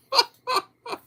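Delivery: shrill giggling burst; high squeal of laughter. Real, not acted. Laughing at Uncle Al's topic.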